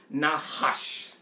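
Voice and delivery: Nahash as nah-HAHSH, pitched low; Hebrew pronunciation. Shown as a demonstration. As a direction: sound the h in the middle of Nahash, ah in both syllables. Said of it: "the correct pronunciation of the word".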